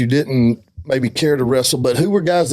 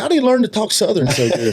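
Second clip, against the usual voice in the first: asked through a laugh, a little higher; laughing mock quote; 6 semitones higher than usual. Co-host jokingly voicing the audience, laughing. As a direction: tease him with a laughing made-up question. Joking about wrestler's Southern talk.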